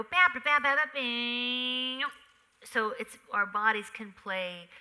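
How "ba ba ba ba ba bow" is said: loud punched syllables, final note held long; drawn-out rhythmic finish. Shown as a demonstration. Actress showing her own version, scatted rhythm with long held ending. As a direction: scat it and stretch the bow way out. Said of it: "But mine might go".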